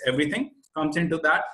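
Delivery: ordinary delivery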